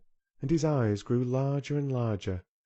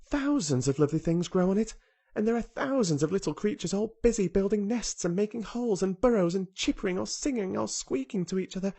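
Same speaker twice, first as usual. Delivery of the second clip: a hint of an earnest young girl, higher; lightly voiced girl Mary; 10 semitones higher than usual. A narrator's reading. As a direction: tell it like a wide-eyed child sharing a secret. Mary enthuses about moor.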